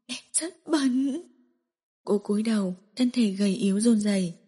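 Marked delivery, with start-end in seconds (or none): 0.1-1.2 slow, higher, soft and broken; hopeless weeping murmur; tearful whisper. Acted. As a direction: let it fall out slowly in tears. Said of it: "giọt nước mắt".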